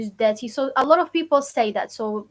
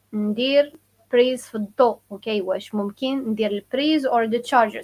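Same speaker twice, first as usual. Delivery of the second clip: normal talking voice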